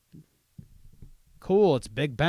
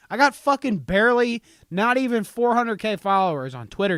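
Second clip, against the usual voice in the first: louder and higher, outraged at the follower count; loud indignant rant; 6 semitones higher, 6 dB louder than usual. Real, not acted. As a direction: rant like it's a personal insult. Ranting about Rubber Ducky's followers.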